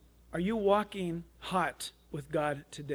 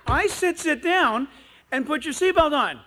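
loud, high exasperated order; scolding mother; 8 semitones higher, 8 dB louder than usual. Retold or imitated. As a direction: play a mom at the end of her patience, voice raised. Mother scolds boy in retold quarrel.

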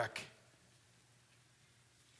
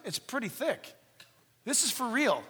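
ordinary talk

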